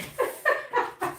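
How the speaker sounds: high, breaking-up giggle; laughter. Real, not acted. Owner tickled by dramatic kittens, high laughter. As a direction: laugh mid-sentence. Laughs at her own sarcasm.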